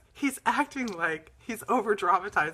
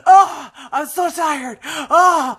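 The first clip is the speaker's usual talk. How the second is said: slow, loud, higher hammed-up groaning; mock exhausted climber; 6 semitones higher, 13 dB louder, much slower than usual. Imitating her husband pretending Everest wore him out, slow and loud. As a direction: ham up the spent mountaineer.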